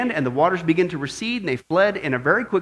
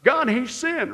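caption read-out; 6 semitones higher, slower than usual. Acted. Same slogan as a posted caption.

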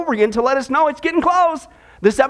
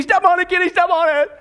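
higher-pitched urgent call; mock yell; 6 semitones higher than usual. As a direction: yell to the driver, played for laughs. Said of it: "he yells to Kenny".

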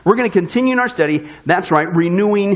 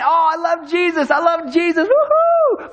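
higher, hammed-up gushing praise ending in a shrill woohoo; mock gushing; 6 semitones higher, slower than usual. Preacher mocks Christians who only claim to love Jesus, hammed-up gushing. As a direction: parody fake enthusiasm, over the top. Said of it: "We go around saying".

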